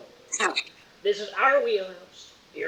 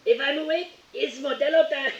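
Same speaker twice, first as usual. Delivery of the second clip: higher, playful meme catchphrase; Modelo meme voice; 7 semitones higher than usual. Retold or imitated. Gamer riffing on the Modelo meme, playful. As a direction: toss off the meme line with a grin. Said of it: "when that meme first started".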